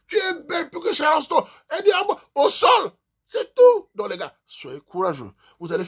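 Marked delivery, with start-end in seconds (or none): 0.1-3.9 high, loud mock wail courting a woman; hammed-up lover. Comedian mocking a man wooing a girl, high wailing. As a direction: overplay a smitten man pleading in tears.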